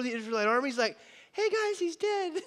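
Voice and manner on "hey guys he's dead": put-on much higher, slow, laughing little boy; a proud kid announcing with a giggle; high boyish voice, drawn out and amused; boy's voice. Boy David holds up head.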